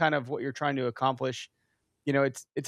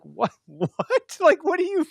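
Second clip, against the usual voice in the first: much higher, louder, half-laughing squeak of disbelief; hammed-up shock, as if the question were absurd; stunned disbelief; 6 dB louder than usual. Retelling his reply to the woman at the canoe, in squeaky hammed-up disbelief.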